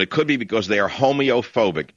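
ordinary delivery

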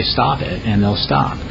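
usual speaking voice

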